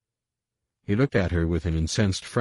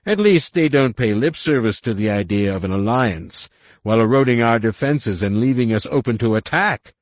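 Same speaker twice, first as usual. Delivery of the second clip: louder, higher scornful accusation; derisive; 5 semitones higher, 7 dB louder than usual. A narrator's reading. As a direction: sneer the charge at her. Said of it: "his voice dripping with derision".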